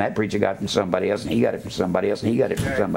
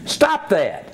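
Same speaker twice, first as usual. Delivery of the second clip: higher, abrupt command falling at the end; sharp scolding; 6 semitones higher than usual. Real, not acted. Preacher to other preachers through the camera, sharp rebuke. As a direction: order them firmly to quit it.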